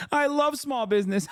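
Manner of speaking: high-pitched giddy squeal; giddy delight. Acted. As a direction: gush it in a very high voice between giggles. Acted giddy delight, between laughs.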